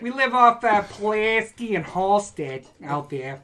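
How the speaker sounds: lightly voiced Chicago accent; a hint of Chicago drawl. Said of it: "bad Chicago accents".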